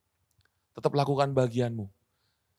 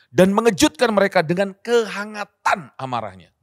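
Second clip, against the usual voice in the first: slow, high, louder voice of God, angry yet warm; warm anger; 8 semitones higher, 7 dB louder than usual. Shown as a demonstration. Preacher voicing God's word with warm anger. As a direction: read it like an angry but warm king. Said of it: "Marah tapi masih hangat".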